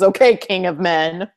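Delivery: slow, dry mocking retort; drawn-out sarcastic put-down. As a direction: humour them with a sarcastic title. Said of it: "I would say it sarcastically".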